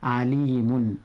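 عليم ending in a clear "-un"; tanween ending. Shown as a demonstration. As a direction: say عليم as "alimun", sounding the final n. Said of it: "بالتنوين فنقول". (نطق عليم بتنوين الضم)

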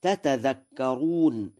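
تتذكرون with both t sounds separately said; original unmerged form. Shown as a demonstration. Teacher showing the original form, two distinct t's in تتذكرون. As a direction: sound out each ت at the start of تتذكرون. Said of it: "لأن الأصل".

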